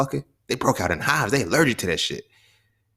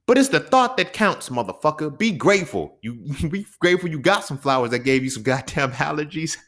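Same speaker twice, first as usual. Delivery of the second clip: mock indignant; 7 semitones higher than usual. Mocking the gift giver.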